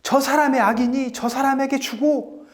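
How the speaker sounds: acted high-pitched desperate begging; wailing plea. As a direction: beg as a mother for her baby's life, pitched high throughout. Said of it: "하면서 막 울부짖는 거였습니다".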